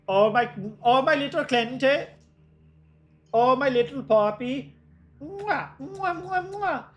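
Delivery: high-pitched Dutch granny with kissy mwahs; Oma Dutch accent. Acted. Streamer plays the Oma doting on a puppy, high Dutch accent. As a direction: coo in a squeaky Dutch grandma accent. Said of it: "the Oma Dutch accent".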